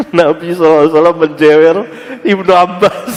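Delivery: high, fast and loud, laughing at the start; triumphant answer. Acted. Scholar silencing his critic with the hadith, gleeful. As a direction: fire back the hadith in triumph. Acting Ibn Taimiyah's answer.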